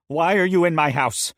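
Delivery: loud, high, shaky challenge; a scared, angry demand; frightened demand. Acted. Frazzled woman aims gun.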